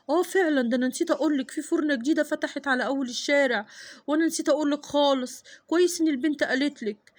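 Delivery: husband admitting he forgot; father. Acted. Father remembers the bakery.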